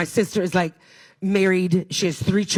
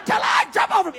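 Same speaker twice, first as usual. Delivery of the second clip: mock yell; 12 semitones higher than usual. Lying down, shouting at fleeing crowd.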